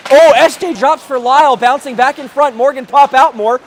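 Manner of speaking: rising excitement on the rush; excited play-by-play. Real, not acted. Rush on the net.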